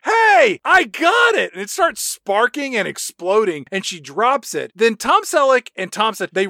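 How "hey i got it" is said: high, loud excited yell; excited shout. Retold or imitated. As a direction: shout it out in triumph. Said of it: "she's like".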